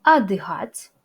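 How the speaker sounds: отдыхать said loud, the first о reduced to a; clear pronunciation. Shown as a demonstration. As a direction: model отдыхать as adykhat, stress on the last syllable. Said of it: "notez la prononciation de long de". (отдыхать prononcé adykhat, accent sur la fin)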